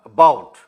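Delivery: ABOUT with a loud, forceful BOUT; teacher demonstrating stress in ABOUT; stress on second syllable. Shown as a demonstration. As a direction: lean hard on the BOUT of ABOUT. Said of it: "ब में वहाँ पर stress है".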